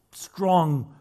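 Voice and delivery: normal talking voice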